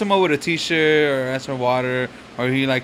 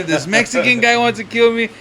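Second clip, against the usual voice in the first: mock fearful plea; 7 semitones higher, 3 dB louder than usual. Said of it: "And he's just telling me".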